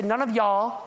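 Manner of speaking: "None of y'all" in a Southern drawl; Southern accent. Shown as a demonstration. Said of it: "if we were in the South, it would say".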